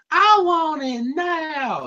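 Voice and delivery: high, slow whiny brat voice; bratty child. Retold or imitated. Mocking Apple Bloom as a whiny demanding brat. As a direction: mimic a spoiled kid demanding.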